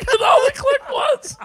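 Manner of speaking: very high, slow, near-crying shout; panicked shout. Retold or imitated. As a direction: wail it in hammed-up terror. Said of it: "I'm like".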